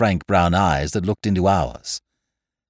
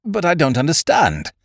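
higher-pitched, baffled nobleman; bewildered; 8 semitones higher, 4 dB louder than usual. Acted. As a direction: protest in utter bewilderment.

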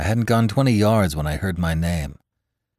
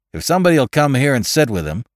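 louder, commanding snap; authoritative snap; 8 semitones higher, 6 dB louder, faster than usual. A narrator's reading. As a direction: order them firmly. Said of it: "he snapped authoritatively".

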